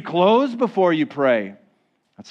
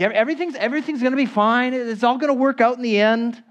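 high, fast falsely cheery voice; mocking a breezy pretense that all is well; mock cheerful; 9 semitones higher, faster than usual. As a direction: brush it off breezily. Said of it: "pretending like".

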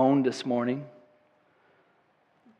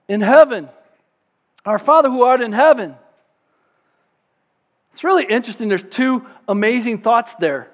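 louder, higher preaching build; 9 semitones higher, 10 dB louder than usual. Acted. Preacher explaining the Lord's Prayer to the church, loud preaching. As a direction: lift it up like a call from the pulpit.

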